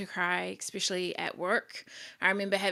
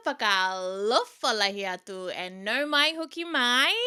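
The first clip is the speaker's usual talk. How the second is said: hyped greeting; 6 semitones higher, 7 dB louder than usual. Real, not acted. Podcaster greeting listeners at the top of the episode, hyped. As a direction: belt out the hellos in an excited sing-song.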